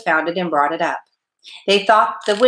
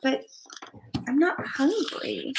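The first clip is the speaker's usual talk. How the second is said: put-on soft, slow, high whispery child; poorly boy, hushed and halting; slumped, weak; 8 semitones higher, 8 dB quieter, slower than usual. A narrator's reading. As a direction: sigh it out feebly in a small voice. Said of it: "he slumped back into the pillow".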